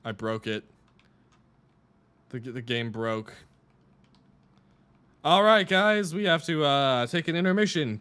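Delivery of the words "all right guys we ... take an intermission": calling out to the whole chat; big raised voice addressing guys; loud announcement. Real, not acted. Announcing game crash to viewers.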